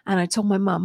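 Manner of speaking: ordinary talk, nothing marked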